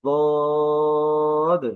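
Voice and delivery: "দদ" with a long, high, steady o; drawn-out letter name. Shown as a demonstration. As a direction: hold the vowel of "দদ", then close on d. Teacher repeats the letter name.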